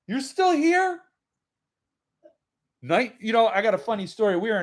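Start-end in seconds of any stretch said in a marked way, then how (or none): 0.1-1.0 mock surprise. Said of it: "Producer Jill's like".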